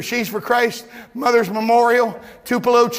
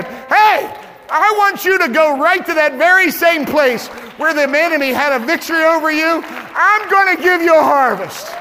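loud, high calling out; the Lord calling out; 7 semitones higher, 7 dB louder than usual. Acted. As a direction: shout the promise with fire. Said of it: "the Lord is saying".

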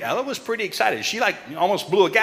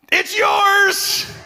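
high, loud announcement; triumphant call of the prize; excited shout; 13 semitones higher, 4 dB louder than usual. Real, not acted. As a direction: shout it out like the big reveal.

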